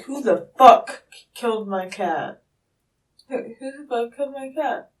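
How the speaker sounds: angry shouted demand over the dead cat; yelling who killed her cat; furious yell. Retold or imitated. Woman recalls yelling at neighbors over her dead cat, furious.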